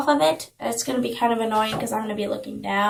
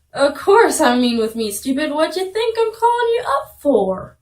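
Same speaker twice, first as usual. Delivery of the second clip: exasperated comeback climbing high in pitch; indignant boy caller; 5 semitones higher, 5 dB louder than usual. Acted. Herbert answering the girl on the phone, indignant. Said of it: "And he said".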